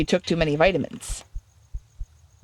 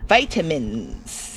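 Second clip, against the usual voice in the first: low drawn-out echo; 5 semitones lower, 3 dB quieter, much slower than usual. Acted. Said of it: "echoing the game's line".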